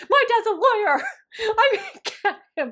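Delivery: high, loud laughing bratty threat; bratty girl threat. Retold or imitated. Campmate to counselors holding mail, laughing bratty threat. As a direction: threaten like a spoiled camper. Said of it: "And one girl said".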